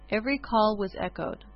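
usual speaking voice

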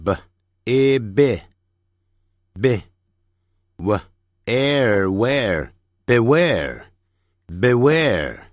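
very slow "b，a，be", then "w，air，where", joined as "beware"; phonics blending. Shown as a demonstration. Teacher drilling beware for learners, slow phonics blending. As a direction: sound out "beware" syllable by syllable with long vowels. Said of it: "注意".

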